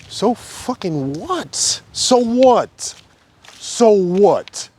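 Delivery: high, slow, contemptuous dismissal; dismissive high challenge; scornful so what. Real, not acted. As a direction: brush it off with rising scorn. Dismissing pride in being first.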